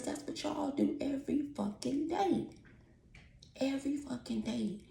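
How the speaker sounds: fed up, aimed at the neighbours; pointed exasperation. Real, not acted. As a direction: land each word high and pointed. Mimicking talking loud at neighbours.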